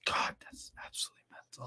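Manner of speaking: hushed, fast whisper; stunned whispered aside. Real, not acted. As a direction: breathe it out in disbelief. Reacting to goal.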